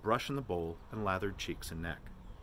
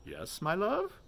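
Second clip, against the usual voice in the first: mock tender; 11 semitones higher, slower than usual. A narrator's reading. Mulligan mocking tender reply.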